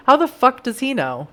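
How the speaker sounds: usual speaking voice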